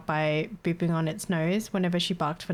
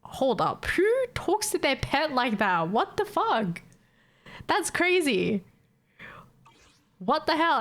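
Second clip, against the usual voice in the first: shocked disbelief; 7 semitones higher, slower than usual. Retold or imitated. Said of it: "I was like".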